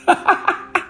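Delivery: sudden high airless giggle; high breathy laugh. Real, not acted. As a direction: let out a squeaky, breathless laugh at your own joke. Burst of laughter after joke.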